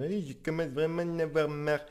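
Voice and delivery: cartoon dad fed up; Homer Simpson voice. Shown as a demonstration. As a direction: do Homer complaining he's had enough. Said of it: "Si jamais il est en train de dire".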